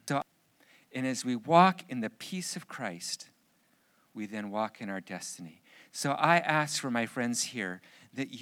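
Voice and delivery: fervent prayer. Acted. Pastor praying over his friends, fervent then hushed. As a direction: pour it out to God, then soften.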